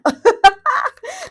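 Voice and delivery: sudden squealing laugh, way up high; laughing at her own outburst; high burst of laughter. Real, not acted. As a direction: let a shrill laugh burst out loud.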